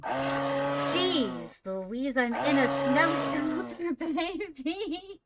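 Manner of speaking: high, loud startled exclamation; excited squeal. Real, not acted. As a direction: blurt it out, giddy and shaken. Startled by friend in shelter.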